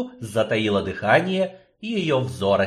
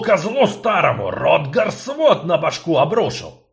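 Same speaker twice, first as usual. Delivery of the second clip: loud cursing dwarf; 5 semitones higher, 4 dB louder than usual. Acted. Dwarf curses.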